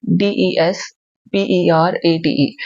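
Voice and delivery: letters drawn out slowly, falling at the end; teacher dictating letters; slow spelling out. Shown as a demonstration. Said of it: "देखो कैसे याद रखना है".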